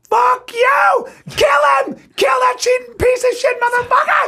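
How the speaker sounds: furious high-pitched shouting, imitating an angry wife; enraged wife. Wife answering the ransom call for her cheating husband, enraged. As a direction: scream it in a loud, high rage. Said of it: "she was so angry".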